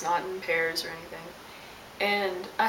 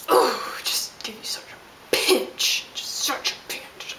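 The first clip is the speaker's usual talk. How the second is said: whispered threat; 3 semitones higher, slower than usual. Real, not acted. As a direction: savour the idea of pinching him, slowly. Fantasizing about pinching him.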